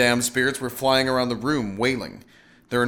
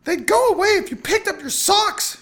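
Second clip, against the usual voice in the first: very high, loud scolding scream; shrieked reproach over noise; 6 dB louder than usual. A narrator's reading. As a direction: scream it as a nagging wife. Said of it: "she'd screamed".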